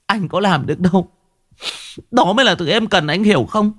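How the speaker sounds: wife crying back at her husband, pitch climbing; choked-up wife. A narrator's reading. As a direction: pour out her grievances through tears. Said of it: "ả mới nghẹn ngào".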